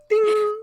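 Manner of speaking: high, ringing "ding"; imitated ding. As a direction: make a high chime sound.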